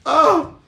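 sharp, very high, loud exasperated cry; frustrated yell. Real, not acted. Priest venting to viewers with a frustrated yell.

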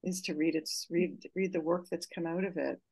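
normal talking voice

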